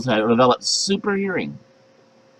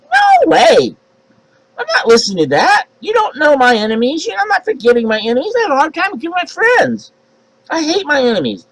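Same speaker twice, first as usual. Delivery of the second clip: indignant believer; 12 semitones higher than usual. Acted. Believer rejecting God's command.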